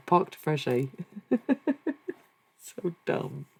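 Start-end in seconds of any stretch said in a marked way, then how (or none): 0.1-0.9 hammed-up, unconvincing Australian accent; Australian accent attempt. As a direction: repeat it as a mock Australian. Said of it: "Wasn't very Australian".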